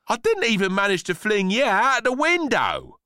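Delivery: put-on high, loud child's protest; protesting boy. A narrator's reading. Boy defends himself to the rhubarb, protesting. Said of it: "the boy protested".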